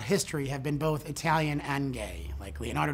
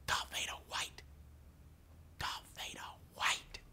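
gasped, breathless whisper; shocked whisper; 15 dB quieter than usual. Retold or imitated. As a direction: hush it out in awe.

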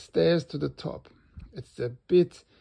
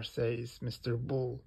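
ordinary delivery, nothing marked

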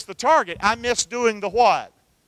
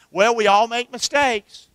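ordinary talk

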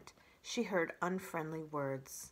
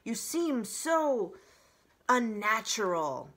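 disdainful; 3 semitones higher, 6 dB louder, slower than usual. Acted. Owl drawls disdain.

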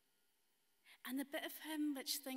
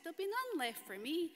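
put-on higher and a touch louder, childish whine; worried greedy boy; 6 semitones higher, 4 dB louder than usual. Storyteller voicing boy fearing no sweets left, high and whiny. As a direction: fret like a kid afraid to lose out.

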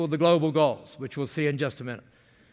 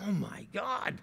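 hushed, voiceless amazement; awed whisper; 4 semitones higher, 10 dB quieter, slower than usual. Acted. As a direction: whisper it, overwhelmed by the idea. Awed reaction to her idea.

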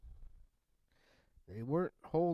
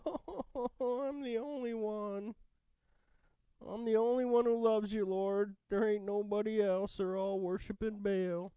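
much higher, whining self-pity with a laugh; mock the prophet's moaning, pitched way up; hammed-up complaining prophet, high and whiny; whiny Isaiah; 8 semitones higher than usual. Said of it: "Here Isaiah is complaining".

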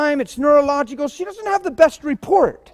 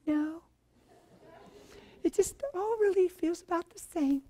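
much softer, deflated answer; quiet flat reply; 4 semitones higher, 16 dB quieter than usual. Retold or imitated. Tammy telling the preacher nothing changed, soft and deflated. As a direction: let it come out small and softly. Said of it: "She says".

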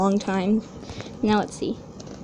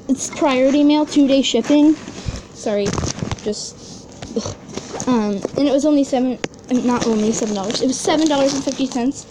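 normal talking voice

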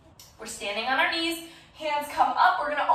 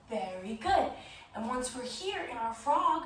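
ordinary talk

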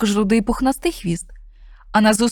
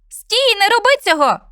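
high, louder alarmed cry; cat shouts; 12 semitones higher, 4 dB louder than usual. Acted. Cat shouts at the snowman, high alarmed cry. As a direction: yell to stop him in time. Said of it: "крикнув сніговикові рудий кіт".

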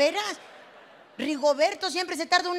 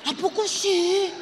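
playing dumb; 4 semitones higher, 3 dB louder, slower than usual. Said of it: "se hacen los".

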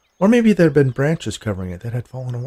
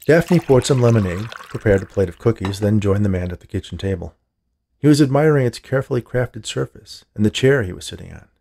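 usual speaking voice

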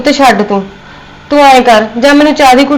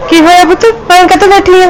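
higher and louder, alarmed concern; worried surprise; 7 semitones higher, 4 dB louder than usual. Acted. Mother-in-law finding her upset, alarmed and concerned.

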